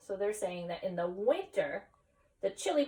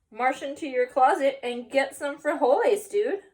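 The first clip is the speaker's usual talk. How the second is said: character voice; 5 semitones higher, 7 dB louder than usual. Acted. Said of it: "said the voice".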